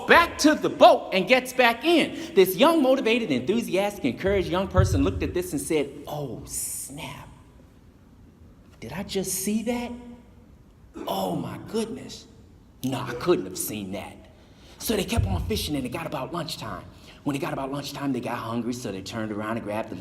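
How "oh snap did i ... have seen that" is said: soft, awed disbelief; softly awed youth muttering to himself; stunned hush. Acted. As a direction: say it under your breath, amazed. Youth stunned, sees walking on water.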